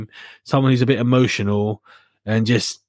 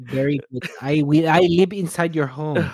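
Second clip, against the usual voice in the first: mock Filipino accent. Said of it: "I'm from the Philippines".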